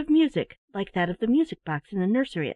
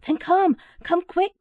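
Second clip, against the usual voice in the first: higher, louder urging; Teddy urges Silverling, higher and louder; urgent cry; 9 semitones higher, 5 dB louder, slower than usual. A narrator's reading. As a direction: hurry him along, calling out. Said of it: "cried Teddy".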